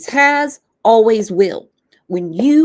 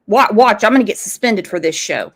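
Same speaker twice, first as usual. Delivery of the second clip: ordinary delivery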